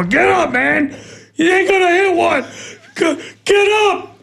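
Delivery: high, impatient shout at the batter; impatient yell. Retold or imitated. Pitcher yelling at a stalling batter, impatient high shout. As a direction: yell at him to get up and swing.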